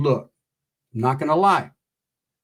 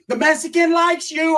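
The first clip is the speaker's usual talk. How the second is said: loud sisters calling; 4 dB louder than usual. Retold or imitated. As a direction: announce it for the whole block to hear. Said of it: "they're loud".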